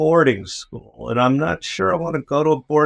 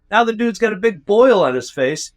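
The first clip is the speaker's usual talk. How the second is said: normal talking voice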